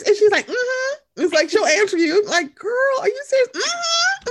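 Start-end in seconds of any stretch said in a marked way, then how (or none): 0.5-1.2 smug high mm-hmm. Retold or imitated. The planner's smug answer. 2.5-4.3 put-on squeaky high, loud girlfriend voice; high sassy squeal. Gossiping with the wedding planner, high sassy squeal.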